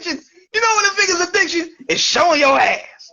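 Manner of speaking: high-pitched, punchy stand-up delivery; Chris Rock impression. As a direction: hammer out the question, then land the punchline. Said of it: "I'm gonna do an imitation".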